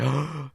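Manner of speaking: sharp mock gasp; audience gasp. Imitating a theater crowd at a trailer, gasp. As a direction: suck in a shocked breath. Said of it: "like, they all gasped".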